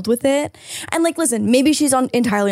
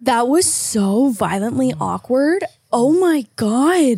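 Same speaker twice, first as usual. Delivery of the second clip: slow cringe; slower than usual. Real, not acted. Cringing at the clip.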